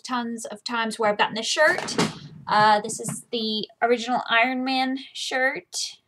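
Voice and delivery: ordinary talk, nothing marked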